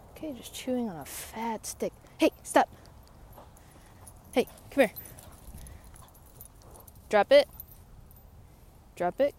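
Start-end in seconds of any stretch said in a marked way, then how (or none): 2.2-9.3 loud, high commands; stern command. Real, not acted. Owner scolding her dog for chewing a stick, loud command. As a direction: order the dog to drop it.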